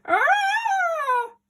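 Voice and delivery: high loud startled scream; frightened scream. A narrator's reading. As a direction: shriek in fright. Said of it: "yelled Granny".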